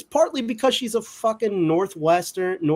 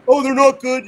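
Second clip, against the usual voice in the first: lightly mocking, higher and louder dismissive whine; mock dismissive fan; 5 semitones higher, 8 dB louder than usual. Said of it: "a person that's just like".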